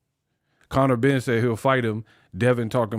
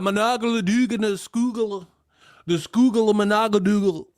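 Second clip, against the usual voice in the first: put-on gibberish Baltimore accent, much higher; mock Baltimore accent; 7 semitones higher than usual. Mocking the Baltimore accent as nonsense, high gibberish Baltimore accent. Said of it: "that accent is crazy too".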